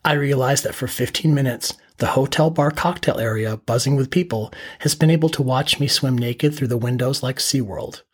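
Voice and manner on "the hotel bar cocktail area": usual speaking voice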